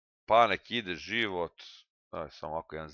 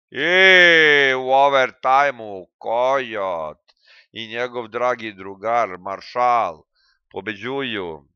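mock announcer; 3 semitones higher, 12 dB louder, slower than usual. Announcing his own win.

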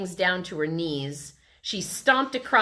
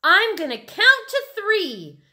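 high, loud warning; shouted threat; 8 dB louder than usual. A narrator's reading. Sheila threatens the twirling kids, shouting. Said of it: "Sheila shouted".

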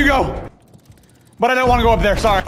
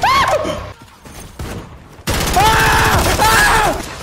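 high panicked yells; scared screams; 12 semitones higher, much slower than usual. Real, not acted. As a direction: scream in fright. Scared by zombies.

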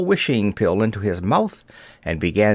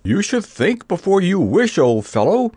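higher-pitched, feeble scolding; weakly gulped; 8 semitones higher than usual. A narrator's reading. The shaken Scarecrow chides the Wizard weakly. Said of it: "gulped the Scarecrow weakly".

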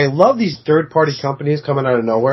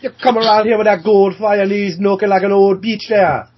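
put-on Jamaican accent; 6 semitones higher than usual. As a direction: rant in a hammy Jamaican accent. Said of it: "in my Jamaican accent".

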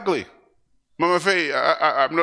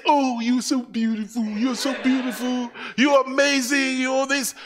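put-on higher, doting baby talk; cooing parent; 7 semitones higher than usual. Preacher voicing parents cooing over their newborn, higher doting coo.

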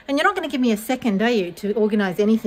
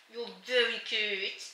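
softer and slower cooing; soft tender coo; 10 dB quieter than usual. Real, not acted. Cooing at her baby.

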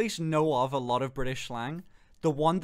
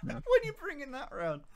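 high-pitched echo of a roadman; 11 semitones higher than usual. Retold or imitated. Copying the other's roadman line, mock roadman. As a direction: mimic a roadman, pitched right up. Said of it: "what roadmen sound like".

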